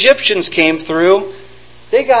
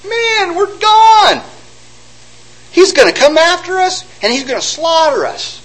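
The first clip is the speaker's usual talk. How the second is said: much higher, sure they're finished; panicked despair; 11 semitones higher than usual. Acted. Israelites cornered by Pharaoh, panicked.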